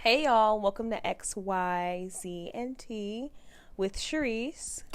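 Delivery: slow, soft podcast intro; mellow. Shown as a demonstration. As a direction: ease gently into a mellow welcome. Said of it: "It's so, like, mellow".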